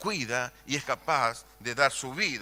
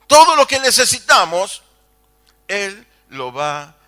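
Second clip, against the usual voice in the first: louder, higher and quick, building to a cry; rising preaching shout; 5 semitones higher, 14 dB louder than usual. Acted. Preacher promising the congregation God provides, shouting. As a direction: lift the promise up to the rafters.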